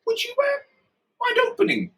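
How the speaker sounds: hesitant, high polite request; wary policeman. Retold or imitated. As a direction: imitate a careful officer asking haltingly. Retold wary policeman asks to open crate.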